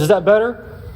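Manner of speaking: lightly voiced framer asking if it's fixed; framer asking innocently. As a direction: mimic the framer checking in, mildly. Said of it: "he looks at me and goes".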